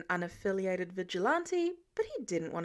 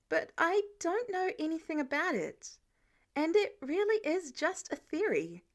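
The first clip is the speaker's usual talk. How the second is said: gentle; 6 semitones higher than usual. Acted. Peter to Tony, carefully polite and gentle. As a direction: tread gently, choosing each word. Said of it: "did his best to sound gentle".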